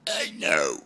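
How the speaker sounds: words burped out low; burping the words. Real, not acted. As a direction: burp the words out.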